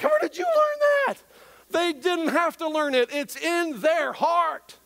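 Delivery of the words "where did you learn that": shrieking disbelief. Pretend. Parent shrieking at toddler in disbelief.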